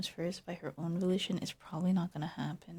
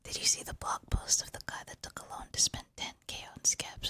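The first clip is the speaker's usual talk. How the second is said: ordinary delivery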